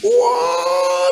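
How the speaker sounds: mock wail, drawn out very high; imitated hysterical wail. Man hugged after forgiving the driver, imitated hysterical wail. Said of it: "dia menangis histeris".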